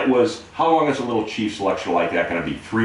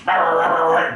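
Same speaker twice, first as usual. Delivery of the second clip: high, louder gruff sailor rant; Popeye rant; 9 semitones higher, 3 dB louder than usual. Retold or imitated. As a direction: bark it out like Popeye. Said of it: "And the chief's".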